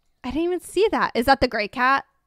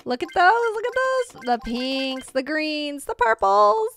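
excited; 7 semitones higher, 6 dB louder than usual. Real, not acted. Admiring colourful new cats.

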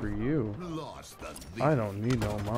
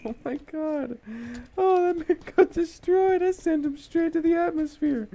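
much higher, loud excited squeal; squealing excitement; 14 semitones higher, 5 dB louder than usual. Real, not acted. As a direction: shriek with delight at the kill. Excited by big kill.

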